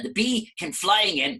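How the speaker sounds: ordinary delivery, nothing marked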